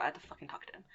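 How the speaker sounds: hushed, exasperated aside under the breath; whispered frustration. Retold or imitated. Telling a friend, annoyed whisper about the guy at the door.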